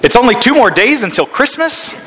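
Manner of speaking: put-on high, amazed child's question; surprised child yelling. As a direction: squeal it out like a shocked little girl. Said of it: "a little girl yells out".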